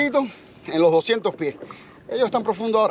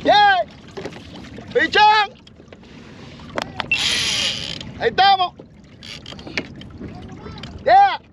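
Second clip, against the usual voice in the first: excited whooping; 9 semitones higher than usual. Real, not acted. Angler cheering as a fish bites, excited shouts.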